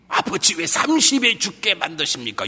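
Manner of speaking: high-pitched mock plea to the Father; mock protest. Preacher acts the wrong response to early death, mock protest. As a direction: complain up to heaven, hammed-up.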